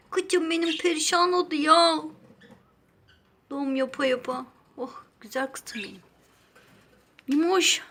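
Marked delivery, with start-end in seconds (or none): 4.8-6.0 soft whispered endearment; tender whisper. Real, not acted. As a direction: breathe it lovingly to the bird.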